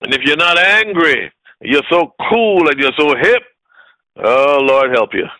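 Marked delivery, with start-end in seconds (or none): none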